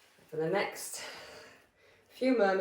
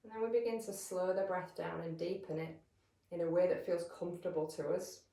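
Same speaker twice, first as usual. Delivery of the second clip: calm, measured meditation voice with long pauses; guided meditation; 7 dB quieter than usual. Real, not acted. Yoga teacher settling listeners into breath awareness, calm guided meditation. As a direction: guide them gently into stillness, leaving space between phrases.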